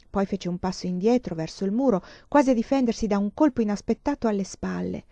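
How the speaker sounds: usual speaking voice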